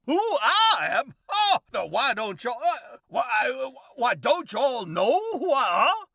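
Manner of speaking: high, loud, pompous drawling windbag; blustery Southern gentleman. Acted. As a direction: lecture the boy like a self-important old colonel. Elrod indignant, laughing.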